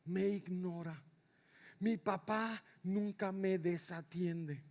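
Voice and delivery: soft phrases with long pauses; slow it right down, quietly affirming; hushed devotional preaching. Real, not acted. Preacher affirming the Father never looks away, slow and hushed.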